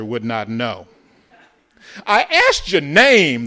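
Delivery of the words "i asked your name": put-on high, very loud angry boy's shout; thundering. A narrator's reading. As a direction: thunder it at him, pitched high. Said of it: "the boy thundered".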